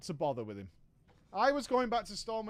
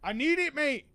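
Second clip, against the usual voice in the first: higher, louder desperate mate; whiny Raven pleading; 5 semitones higher, 4 dB louder than usual. Retold or imitated. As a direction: beg for the money like a needy bloke.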